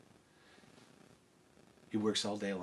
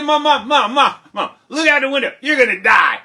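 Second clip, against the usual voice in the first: high, loud, insistent calling; excited yelling; 17 dB louder than usual. Acted. Jack urging his mother to look outside, excited shouting. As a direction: pester loudly with breathless urgency.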